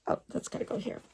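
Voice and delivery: voiceless muttered aside; whispered aside. Real, not acted. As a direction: mutter it to yourself. Aside while arranging.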